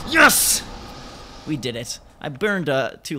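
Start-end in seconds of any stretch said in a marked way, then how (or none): 0.1-0.6 high, loud victory yell; short explosive burst of joy; triumphant cheer. Real, not acted. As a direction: shout it in victory. Celebrates the boss win.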